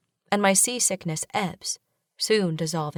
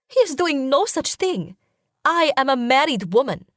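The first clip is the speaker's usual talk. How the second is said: much higher and louder, indignant disbelief; astonished; 8 semitones higher, 5 dB louder than usual. A narrator's reading. Wife astonished at her husband's jealousy, indignant and high. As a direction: protest in shocked outrage. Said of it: "I say, astonished".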